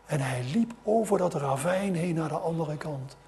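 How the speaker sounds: usual speaking voice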